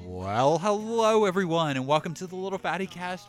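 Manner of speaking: big showy host welcome; announcer voice. Acted. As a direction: open the show like a grand emcee. Host persona opens the show.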